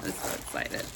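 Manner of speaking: hushed, voiceless excitement; excited whisper. Real, not acted. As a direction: whisper it, barely containing excitement. Overwhelmed by unboxing excitement.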